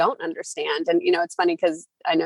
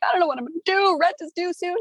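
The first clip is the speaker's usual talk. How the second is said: high, anxious voice for the freaked-out roommate; panicked friend imitation; 12 semitones higher than usual. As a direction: play the friend in a rent panic, pitched way up. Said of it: "called me freaking out".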